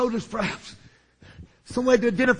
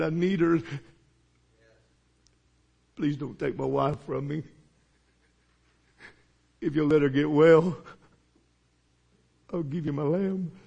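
quiet pleading prayer; 8 semitones lower, 5 dB quieter than usual. Acted. Blind man pleads with God.

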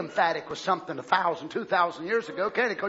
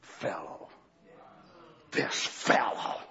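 contemptuous fellow; 6 dB quieter, much slower than usual. Shown as a demonstration. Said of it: "a base word that was used for common criminals".

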